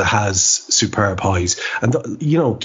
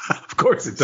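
laughing; 5 semitones higher, 3 dB quieter than usual. Real, not acted. Laughing at the recital clash.

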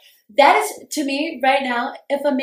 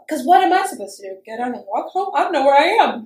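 exasperated protest; 3 semitones higher, 5 dB louder than usual. Retold or imitated. Retold helpless protest, left in car.

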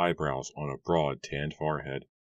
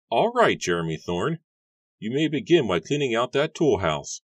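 much higher, put-on voice for the farmer; gruff farmer Matthew; 7 semitones higher, 5 dB louder than usual. A narrator's reading. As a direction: play the grumpy farmer, pitched way up. Matthew hires the young man.

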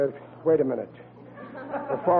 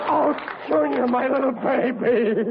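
gushing relief; 7 semitones higher than usual. Acted. Son Junior suddenly comes home.

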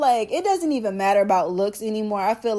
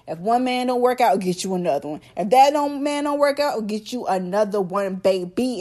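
ordinary talk, nothing marked

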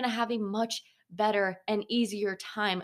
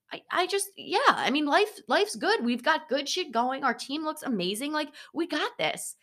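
higher-pitched, cheerful re-enactment of her positivity; upbeat self-quote; 7 semitones higher than usual. Retold or imitated. College gymnast answering teammates who say she's so happy, upbeat. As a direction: play back a relentlessly positive past self.

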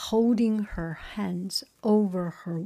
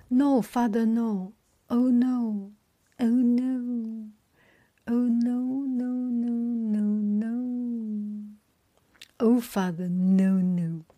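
pleading wail; 3 semitones higher than usual. Acted. Daphne begging her father not to marry her off, wailing. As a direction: plead in long, acted moans.

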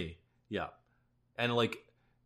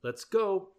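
determined go-ahead; 11 semitones higher, 4 dB louder, slower than usual. Retold or imitated. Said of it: "we're like".

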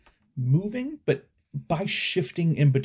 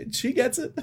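excited; 11 semitones higher than usual. Acted. Toffee points at laughing fan.

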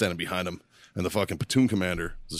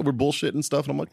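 ordinary delivery

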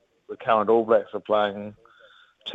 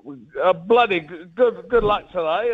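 higher, chatty, a bit hesitant caller on the phone; imitating a friendly Kiwi fan; 7 semitones higher than usual. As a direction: play the eager local fan ringing to wish luck. Fan caller wishing luck.

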